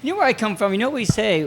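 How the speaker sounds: usual speaking voice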